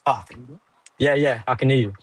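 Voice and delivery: ordinary talk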